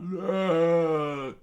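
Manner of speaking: high, loud drawn-out shout; Marimo screaming. Acted. Henchman alerting others to the flag, screamed. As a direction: yell to get everyone looking up. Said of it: "Marimo sc-, uh, screamed out".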